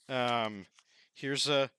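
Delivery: ordinary delivery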